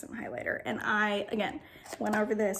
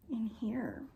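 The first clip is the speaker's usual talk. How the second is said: whisper; 5 dB quieter than usual. Real, not acted. Makeup try-on, hushed aside.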